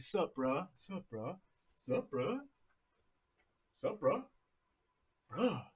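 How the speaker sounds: lazy surfer-dude greetings traded back and forth; mock California dude chat; surfer slang exchange. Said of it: "a sup, brah fight".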